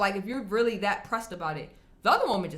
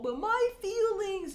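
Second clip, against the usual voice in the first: much higher, slow whiny mockery; mock whine; 12 semitones higher, slower than usual. Vlogger mocking people who reject stats, slow mock whine. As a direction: drag it out like a hurt, whiny complaint.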